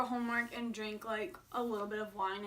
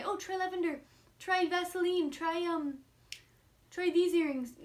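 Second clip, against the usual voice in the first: mocking advice; 7 semitones higher than usual. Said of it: "everything that you're gonna say".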